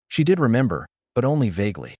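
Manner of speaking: plain reading voice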